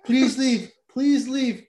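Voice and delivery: mock emergency broadcast, high and loud; blaring TV alert. TV in the living room blaring an evacuation warning, loud and high. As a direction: blare it like a TV warning. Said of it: "it's blaring fucking".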